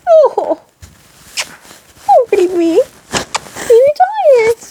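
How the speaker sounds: high, slow cooing pet voice. Real, not acted.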